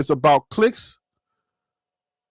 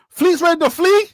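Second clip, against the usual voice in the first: mock disbelief; 8 semitones higher, 3 dB louder than usual. Real, not acted. Joking pun in disbelief.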